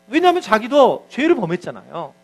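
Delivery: ordinary talk, nothing marked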